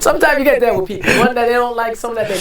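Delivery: words through real laughter; laughing through the words. Real, not acted. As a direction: chuckle the line out, fast and high. Laughing while talking.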